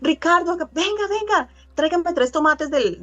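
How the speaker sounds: high, bright calling voice to a son; calling a child. Retold or imitated. Mother calling her son Ricardo to fetch tomatoes, high call. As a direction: call the boy over, high and lively. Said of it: "Yo le digo".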